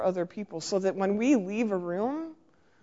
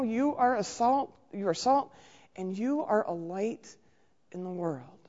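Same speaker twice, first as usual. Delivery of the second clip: usual speaking voice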